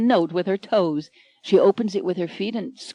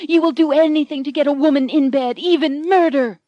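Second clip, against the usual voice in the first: high, melodramatic threat; vengeful heroine; 6 semitones higher, 3 dB louder than usual. Acted. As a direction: vow revenge like a stage heroine. Anne accuses Michael.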